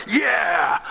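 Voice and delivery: shouted "Yeah!" like a cheering crowd. Retold or imitated. Preacher imitating a cheering crowd, shouting. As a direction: whoop it like the men in the movie. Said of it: "Every man just goes".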